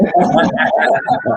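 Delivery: short breathy laugh; a quick amused laugh. Real, not acted. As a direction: laugh at the joke. Laughing at the Hollywood joke.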